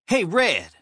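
high, lightly shouted greeting; shouting out. A narrator's reading. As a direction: hail someone from across the way. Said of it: "Alex shouted out to him".

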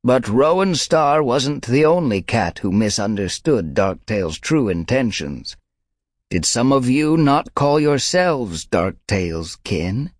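elder she-cat rebuking; rasping old she-cat. A narrator's reading. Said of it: "The rasping voice".

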